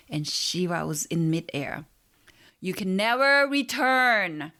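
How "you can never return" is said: slow, high, loud yell. A narrator's reading. Shiva yells from midair that there is no return. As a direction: stretch it out as a loud warning. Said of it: "he yelled".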